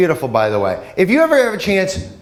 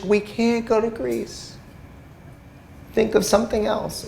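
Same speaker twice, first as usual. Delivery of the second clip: lightly voiced wife, softer; 4 semitones higher, 7 dB quieter than usual. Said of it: "wife voiced".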